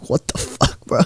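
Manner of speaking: hushed, voiceless disbelief; acted aghast whisper to a bro; stunned whisper. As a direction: whisper it in mock shock. His stunned reaction, acted.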